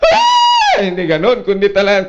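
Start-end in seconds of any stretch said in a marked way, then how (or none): 0.0-0.3 mock wailing cry. Shown as a demonstration. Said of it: "hindi na".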